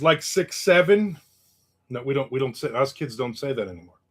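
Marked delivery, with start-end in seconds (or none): 0.0-1.2 slow, drawn-out reading; reading a comment. Retold or imitated. Reading a viewer's comment, slow and drawn-out. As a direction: drag the words out slowly. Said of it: "Frank says".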